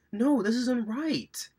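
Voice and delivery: high-pitched, drawn-out objection; mock protest.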